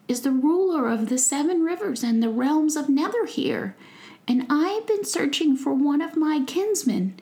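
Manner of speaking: a proud prince greeting a goblin kindly; noble young prince. Acted. Prince introducing himself to goblin, courtly and polite.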